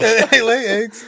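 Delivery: slow, high mocking repeat; mocking echo. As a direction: drag it out to mock him. Echoing partner's dinosaur fact.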